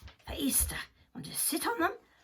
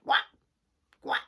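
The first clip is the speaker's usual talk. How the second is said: duck quack; 9 dB louder than usual. Acted. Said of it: "said the duck".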